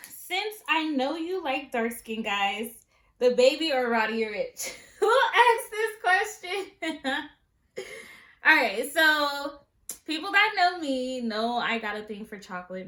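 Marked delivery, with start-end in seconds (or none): 3.2-7.8 laughing through the question, high and loud; giggling in disbelief at a cheeky question. Real, not acted. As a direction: crack up at the question.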